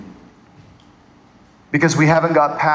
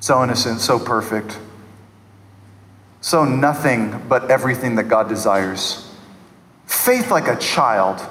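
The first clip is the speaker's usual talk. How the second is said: low, softened reverence; tender admiration; 7 semitones lower than usual. Real, not acted. Preacher marvelling at new believer's innocent prayer, tender. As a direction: savour it tenderly, dropping your pitch.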